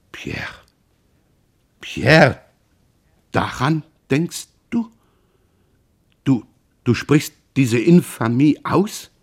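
breaks high, slow and aghast; horrified, can't believe it; wounded brother aghast at the insult; stunned disbelief. Acted.

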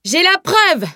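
very high, triumphant yell; excited yell. A narrator's reading. Boaster seizing his witness before his friends, excited yell. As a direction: bellow it in wild excitement. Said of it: "hurla-t-il".

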